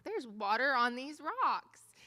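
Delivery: loud, high, stretched-out exclamation of wonder; amazed excitement. Retold or imitated. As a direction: cry it out slowly, thrilled by the discovery. Retelling amazed discovery of water.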